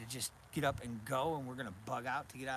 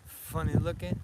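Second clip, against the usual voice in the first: cooing at the dog; 6 semitones higher, 7 dB louder than usual. Real, not acted. Cooing at puppy.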